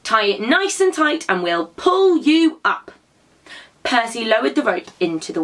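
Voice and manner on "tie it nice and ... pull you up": calling out. A narrator's reading. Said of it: "called Percy".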